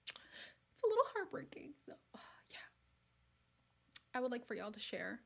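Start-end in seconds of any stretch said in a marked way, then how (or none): 0.1-1.7 high mock-sad wail; mock lament. Real, not acted. Lamenting Canadian men, a high mock-sad wail. 1.9-2.6 breathy sighed whisper; sighing whisper. Real, not acted. Giving up on Canadian men, a sighed whisper.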